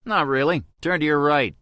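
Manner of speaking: spring the surprise with a grin; playful teasing. A narrator's reading. Toby reveals he is nearby.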